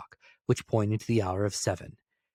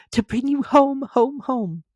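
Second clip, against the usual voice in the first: put-on high, bright-eyed child pleading gently; a small sister eager to take him home; little girl voice; 7 dB louder, slower than usual. Little sister calls brother home, high child voice. Fan repeating gleefully.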